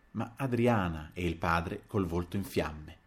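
plain reading voice

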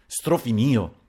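ordinary delivery, nothing marked

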